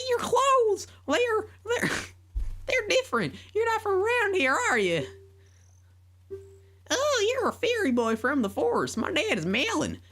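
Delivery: put-on very high girl voice with a country accent; country farm girl voice, high and sweet. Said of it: "a country accent".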